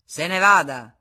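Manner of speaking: loud, commanding dismissal; loud order. Acted. Senecal ordering Federico to leave, loud. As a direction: order him out.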